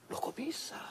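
breathy stunned whisper, imitating his own shock; a whispered retold gasp of disbelief; astonished whisper. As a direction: hush it like you can't believe it. Retold astonished reaction in Makassar.

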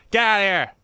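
shout at intruder. Real, not acted. Yelling at game intruder.

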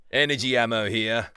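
loud game-character callout; Fuse impression. Player proving to teammate he is Fuse, loud impression. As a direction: call out the ping like the character. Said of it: "that's quite a good impression".

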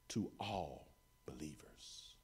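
breathy hushed whisper, slowed. Real, not acted. Preacher whispering to the congregation, hushed and slow. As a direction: lean in and whisper it to the church.

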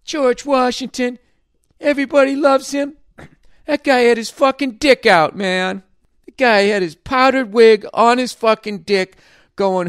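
high, loud stoner voice saying man; mock hippie historian. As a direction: play a ranting counterculture teacher, way up and loud. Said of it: "It was just like".

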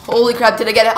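normal talking voice

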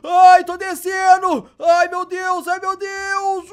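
loud, high, slow yell while falling; panicked yell. Acted. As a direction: yell out in alarm as you drop. Hero falling, yelling in game.

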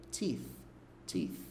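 "Teeth" quiet, ending in th with just the tongue tip; only the tip of the tongue on the th of "Teeth". Shown as a demonstration. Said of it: "É só a pontinha". (só a pontinha da língua no "teeth")

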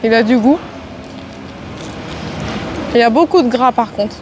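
talking while chewing food; slightly muffled eating speech; mouth full, chewing. Real, not acted. Seen 2: Bit the chicken just before; jaw working, food held at mouth.

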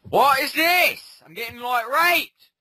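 drawn-out yell, high and loud; real frustrated yelling. Real, not acted. Player yelling at the screen while being attacked, high loud yell. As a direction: howl in disbelief at getting hit. Raging at getting hit in game.

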